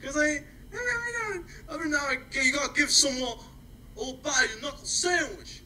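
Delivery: hammed-up Brooklyn wiseguy bluster; Brooklyn tough guy. Playful Brooklyn accent joking to his co-host about knuckle sandwiches. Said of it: "romanticizing my Brooklyn roots, and it's really been coming out".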